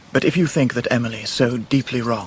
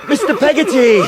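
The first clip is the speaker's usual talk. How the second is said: loud high call down the street; 12 semitones higher, 6 dB louder than usual. Acted. David calling Peggotty over to Martha, loud and high. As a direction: call him over from afar.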